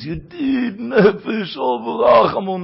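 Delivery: crying out the words through tears; a pleading cry, voice breaking; weeping plea. Acted. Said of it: "Und er weint".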